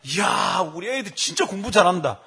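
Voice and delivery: loud, high burst of fatherly pride; retold excited cheering; delighted praise. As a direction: cheer your kids' perfect scores. Retold delighted praise of his children.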